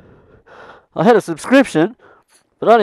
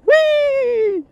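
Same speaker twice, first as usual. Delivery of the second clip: gleeful whee. Real, not acted. Coasting downhill on his bike, gleeful high whee. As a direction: ride the thrill, high and long.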